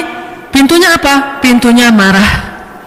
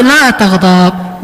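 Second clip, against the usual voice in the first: slow solemn quote; 4 semitones lower, much slower than usual. Retold or imitated. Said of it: "Kata Nabi".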